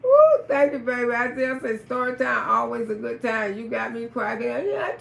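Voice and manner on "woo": high loud whoop to the crowd; excited whoop. Real, not acted. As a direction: cheer them on with a big whoop. Hyping the crowd.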